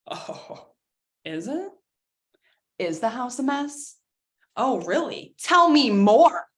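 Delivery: mock-sweet sarcasm building to a loud snap; sarcastic retort that ends loud and slow. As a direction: needle them, then blow up.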